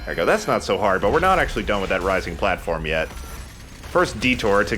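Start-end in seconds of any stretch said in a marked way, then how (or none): none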